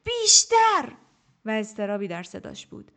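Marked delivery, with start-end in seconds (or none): 0.0-0.9 high, much louder all-out yell; shouted order. A narrator's reading. Teacher screaming at her pupil to give more. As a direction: scream the word at her to push. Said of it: "دوباره گفت".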